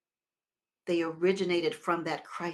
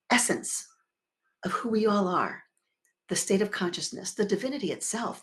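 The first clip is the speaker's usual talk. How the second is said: ordinary talk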